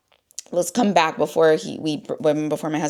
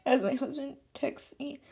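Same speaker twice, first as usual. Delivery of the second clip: real tears, high voice breaking into sobs; crying; 8 semitones higher than usual. Real, not acted. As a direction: try to steady yourself while crying. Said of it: "not because I'm crying".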